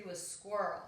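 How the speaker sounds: usual speaking voice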